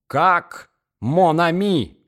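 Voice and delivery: loud, high, slow indignation; frenzied outcry. Acted. Enraged young man answers her friendly greeting, frenzied. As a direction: throw the pet name back in a drawn-out, furious cry. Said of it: "вскричал он в бешенстве".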